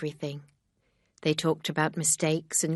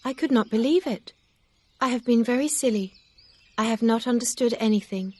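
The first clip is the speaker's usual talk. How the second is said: higher, louder voice for a distressed Emma; Emma, upset young woman; 6 semitones higher, 4 dB louder than usual. A narrator's reading. Emma confessing her foolishness to Mister Knightley, higher and distressed.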